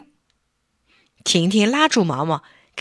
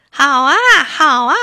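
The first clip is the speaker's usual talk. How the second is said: higher, louder happy cheer; children shouting; 7 semitones higher, 8 dB louder than usual. Acted. Children cheer for the group photo, loud. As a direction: cheer like excited kids. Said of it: "小朋友也嚷着".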